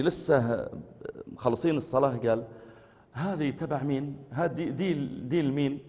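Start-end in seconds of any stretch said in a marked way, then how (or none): 3.1-5.8 soft, quiet Egyptian dialect asking; a hushed Egyptian man asking who owns it; curious Egyptian murmur. Retold or imitated. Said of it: "خرج أخ مصري".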